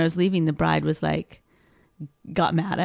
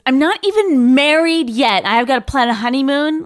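higher and louder, indignant snap; mock-indignant bride, higher and louder; retold snappy retort; 7 semitones higher, 7 dB louder than usual. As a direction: throw it back at him, offended.

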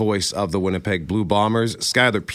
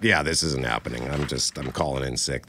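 groggy quiet; 9 semitones lower, 6 dB quieter than usual. Retold or imitated. Exhausted after a sleepless night.

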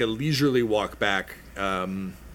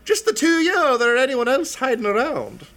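gnome voice; 10 semitones higher, 4 dB louder than usual. Acted. Gnome asking the knight to lift the visor, high. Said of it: "She's like".

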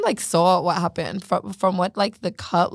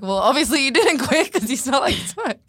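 higher-pitched, laughing disbelief; retold incredulous retort; 6 semitones higher than usual. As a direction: call out an obvious lie, half laughing. Retelling her retort to nanny.

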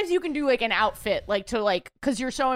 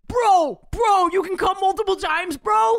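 louder, excited bro talk; 5 semitones higher, 4 dB louder than usual. Retold or imitated. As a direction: hype it up like a gym bro. Imitating excited guys.

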